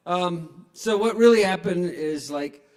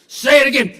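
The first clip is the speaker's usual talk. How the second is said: commanding; 9 semitones higher, 12 dB louder than usual. Pretend. Fantasy demand.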